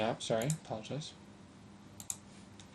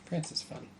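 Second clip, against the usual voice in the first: breathy whisper; 5 semitones higher, 9 dB quieter than usual. Real, not acted. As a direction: say it under your breath. Whispered aside.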